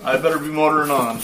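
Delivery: high, cheery country voice; friendly local signing off; farmer voice. Acted.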